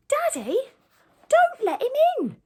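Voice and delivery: put-on very high, loud child's cry. A narrator's reading. Daughter begs her father at the gate, crying out shrilly. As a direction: shriek a warning as the little daughter. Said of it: "the gatekeeper's daughter cries".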